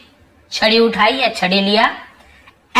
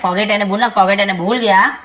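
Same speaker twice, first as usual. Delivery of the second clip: ordinary talk, nothing marked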